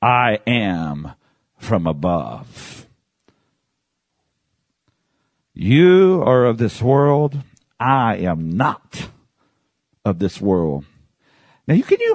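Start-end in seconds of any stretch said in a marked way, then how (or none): 0.0-10.9 very slow, low and measured; slow, solemn declaration. Acted. Preacher voicing Jesus to the religious leaders, slow and solemn. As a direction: draw out each phrase with weighty pauses.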